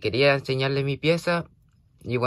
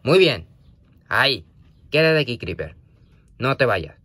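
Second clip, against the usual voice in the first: normal talking voice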